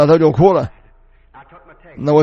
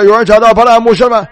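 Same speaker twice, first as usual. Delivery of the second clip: raised, louder call for someone; 4 semitones higher, 5 dB louder than usual. Retold or imitated. Asking the congregation who has found pastor 巴拉, calling out. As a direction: call the question out over a crowd. 问会众牧师在哪儿.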